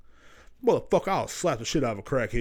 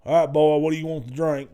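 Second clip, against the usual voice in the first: put-on louder, a touch of a gruff old worker; grandpa's crew, gruff; 4 semitones higher, 6 dB louder than usual. Old woodcutter asking the boy about drinks, louder, lightly gruff. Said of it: "being assholes".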